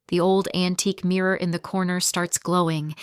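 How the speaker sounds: ordinary delivery, nothing marked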